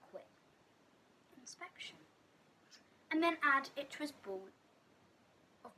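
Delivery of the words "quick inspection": whispered inspection. Acted. Quick inspection of snot.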